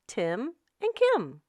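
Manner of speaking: plain reading voice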